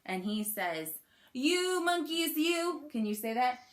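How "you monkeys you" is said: slow, high-pitched scolding; storybook anger, drawn out and raised in pitch; mad peddler. Acted. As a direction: scold them with playful, hammed-up anger. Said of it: "we've gotta get mad".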